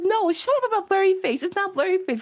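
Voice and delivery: high, snapping mock irritation; mock annoyed sister. Imitating her annoyed sister shutting her down, high and snappy.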